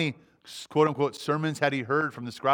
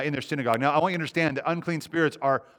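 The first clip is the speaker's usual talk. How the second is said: ordinary talk, nothing marked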